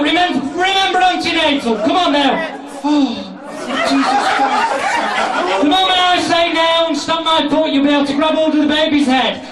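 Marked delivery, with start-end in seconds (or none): 3.9-5.6 high, drawn-out shriek. Acted. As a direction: squeal it in mock panic. Mock scream during birth.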